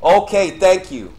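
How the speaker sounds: annoyed loud burst; exasperated yelling. Real, not acted. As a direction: shout it at the game, fed up. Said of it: "Sorry for the yelling".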